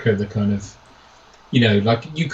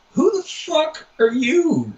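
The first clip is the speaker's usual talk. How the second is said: high, drawn-out mocking voice; incredulous film hanger-on; 14 semitones higher, much slower than usual. Fan quoting a character to a newcomer, high incredulous impression. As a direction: stretch it out high in mock disbelief. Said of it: "he's just like".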